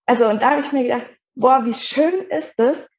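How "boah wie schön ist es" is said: slow, higher-pitched awe; retold wonder. Retells her moved reaction. (staunend und gedehnt)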